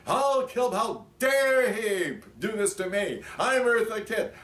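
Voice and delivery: mock furious high shriek. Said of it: "she was screaming all the way".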